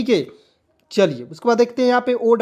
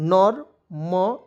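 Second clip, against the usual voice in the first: normally stretched out very slowly; drawn-out demo word; much slower than usual. Shown as a demonstration. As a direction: spell out normally slowly for learners. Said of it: "तो इसे पढ़ेंगे दोस्तों". (normally को बहुत धीरे खींचकर)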